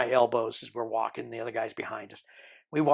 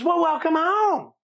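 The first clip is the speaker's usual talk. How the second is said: much higher, louder and slow put-on welcome; imitating border officer; 7 dB louder, slower than usual. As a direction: stretch out her welcome in a high borrowed voice.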